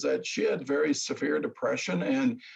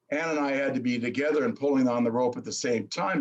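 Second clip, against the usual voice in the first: normal talking voice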